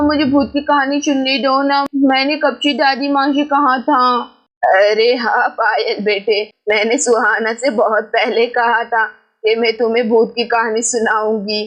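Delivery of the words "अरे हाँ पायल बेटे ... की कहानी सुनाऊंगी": put-on old grandmother. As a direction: speak low and unhurried like an elderly grandma. Grandmother voiced by narrator.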